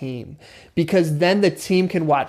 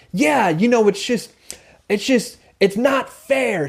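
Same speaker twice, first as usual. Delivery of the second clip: higher-pitched, acted frustration; frustrated; 6 semitones higher than usual. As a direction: let the complaint spill out, pitch jumping up. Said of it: "somebody's getting really frustrated".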